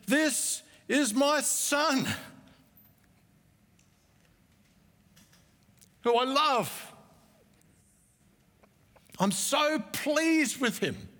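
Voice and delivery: high, slow, proud declaration from heaven; voice of God the Father. Retold or imitated. Preacher voicing the Father at Jesus' baptism, high and slow. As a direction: proclaim it like a father bursting with delight. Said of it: "a voice came from heaven".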